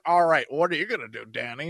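low, gruff-ish mock sneer; mock Ward voice. Mocking Ward asking Danny his plan, low. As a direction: parody Ward talking down. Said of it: "Ward's like".

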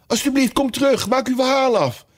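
very high, pleading call; calling after. A narrator's reading. Alice calling after the departing mouse, imploring. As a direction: call out for them to come back. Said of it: "riep Alice me na".

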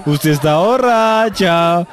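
slow drawn-out question. Acted. Prank caller to the laughing woman, slow drawn-out mock accusation. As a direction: stretch ¿Usted está borracha? out slowly, every syllable dragged. Prank call, asking twice.

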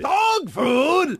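high shouted disbelief; shouted echo. Retold or imitated. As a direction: yell it back in outrage. Echoed movie quote.

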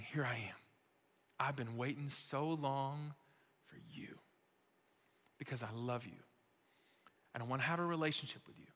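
soft, low, falling to a whisper; hushed tenderness. Acted. Preacher voicing Jesus waiting for you, hushed tenderness. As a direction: say it gently, leaving room between phrases.

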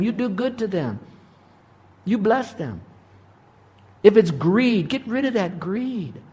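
high-pitched, insistent preaching; fervent exhortation. Real, not acted. Preacher tells congregation to forsake sin and forgive, fervent exhortation.

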